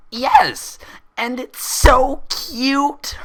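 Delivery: high, loud, slow gleeful shout. Acted. Pinkie shouting at Twilight's bandage, high and delighted. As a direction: burst out with giddy glee. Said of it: "Pinkie Pie shouted".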